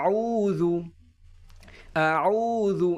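throat-born ain in আউযু; Arabic ain sound. Shown as a demonstration. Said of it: "আইনটা গলার ভিতর থেকে উচ্চারণ হবে".

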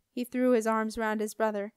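ordinary delivery, nothing marked

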